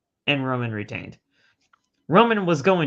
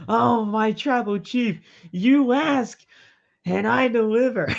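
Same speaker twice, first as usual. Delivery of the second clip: high fawning mock Heyman; hammed-up fawning manager; 7 semitones higher, 3 dB louder than usual. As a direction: grovel like a delighted sidekick. Heyman voiced fawning to Reigns.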